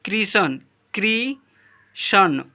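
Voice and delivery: क्रिशन said slowly and drawn out on the repeat; model pronunciation. Shown as a demonstration. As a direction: spell it out as क्रिशन, stretching it. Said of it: "इसे पढ़ेंगे". (उच्चारण का नमूना, धीरे से क्रिशन)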